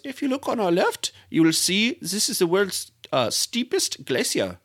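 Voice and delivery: high-pitched imitation of an Icelandic tour guide; Icelandic-accented driver announcing to his passengers; Icelandic guide's accent. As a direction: point out sights in a lilting Icelandic accent. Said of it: "this is guy driving, and he goes".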